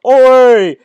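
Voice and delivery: high, loud silly call; mock shout. Ad-libbing the reading, shouting as a proton. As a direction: yell it as the proton. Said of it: "the proton would go up to the neutron and be like".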